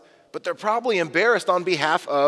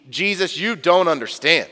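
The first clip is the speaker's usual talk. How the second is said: louder, slowing to a firm refusal; belligerent Peter; 5 dB louder than usual. Pretend. Peter refusing to let Jesus wash his feet, mock belligerent. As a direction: Peter putting his foot down. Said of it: "Peter gets more belligerent".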